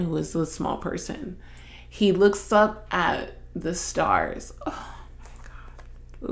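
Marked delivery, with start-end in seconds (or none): 4.7-6.3 breathy whisper, slow; whispered awe. Real, not acted. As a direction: whisper it, overcome by the memory. Overcome recalling movie scene.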